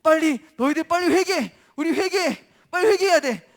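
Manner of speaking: loud, high alarmed commands; rally the people as a panicked king; urgent king. Acted. King rallies city in emergency.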